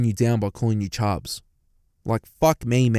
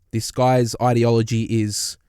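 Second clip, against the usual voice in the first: ordinary delivery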